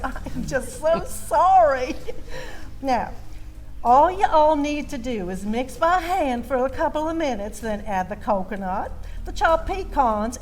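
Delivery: ordinary delivery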